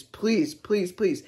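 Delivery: restrained, repeated pleading; retold begging. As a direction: implore with each please. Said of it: "begging her".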